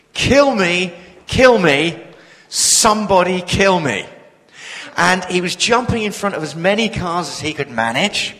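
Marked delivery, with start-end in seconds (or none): 0.1-4.1 mock yell of a desperate man, a bit louder; retold scream. Preacher mimicking the man screaming in traffic, mild mock yell. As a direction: half-shout the plea like the man in traffic. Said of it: "screaming at the top of his voice".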